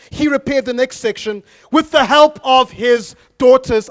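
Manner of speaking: loud, high preacher's voice; fired-up sermon delivery; full-force preaching. Acted. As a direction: preach it out to the whole room.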